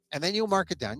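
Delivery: usual speaking voice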